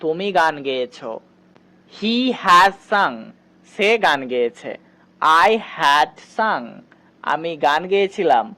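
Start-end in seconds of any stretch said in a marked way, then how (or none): 1.9-3.2 very slow, stretching sung; slow model sentence. Shown as a demonstration. Teacher telling students to notice how she says it. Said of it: "খেয়াল করো কিভাবে আমি এগুলো ইংরেজিতে বলছি". 5.4-6.7 very slow, stretching sung; slow model sentence. Shown as a demonstration. Teacher telling students to notice how she says it. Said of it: "খেয়াল করো কিভাবে আমি এগুলো ইংরেজিতে বলছি".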